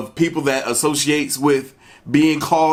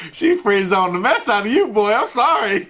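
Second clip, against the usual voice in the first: laughing; 8 semitones higher than usual. Real, not acted. Laughing reaction to Keever line.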